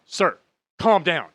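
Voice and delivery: slow, loud, high mock scolding; imitated yelling. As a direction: imitate an agent firmly ordering a passenger. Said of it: "she's yelling at me".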